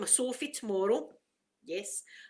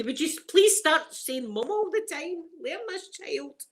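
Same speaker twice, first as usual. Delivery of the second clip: higher-pitched exasperated parent's plea; mock pleading; 7 semitones higher than usual. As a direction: beg them, half in jest, with pitch lifted. Retold parent pleading with everybody.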